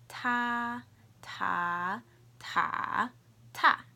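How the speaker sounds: loud "Ta" four ways: high level, rising, dipping, falling; four tones demo. Shown as a demonstration. Teacher modelling for learners, four tones on "Ta". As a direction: step "Ta" through the four Mandarin tones, last one short. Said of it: "I'll say the four tones".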